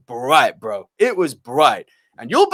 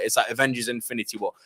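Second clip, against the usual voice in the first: ordinary talk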